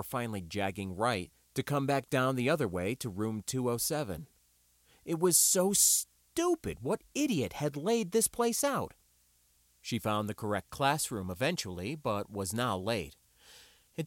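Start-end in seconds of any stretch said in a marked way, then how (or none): 5.1-8.9 annoyed inner rant. A narrator's reading.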